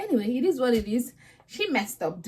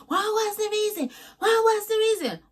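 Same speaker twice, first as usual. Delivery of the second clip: much higher, mock [name] demand; [name] impression; 11 semitones higher than usual. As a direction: squeal the question like [name]. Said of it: "according to [name]".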